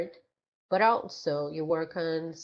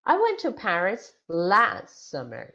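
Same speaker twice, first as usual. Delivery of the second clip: last summer punched out loud; stress on last summer; 2 semitones higher, 7 dB louder than usual. Shown as a demonstration. Answering when did you go to Paris, stress on last summer. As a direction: hit the words last summer hard. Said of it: "the stress is on last summer".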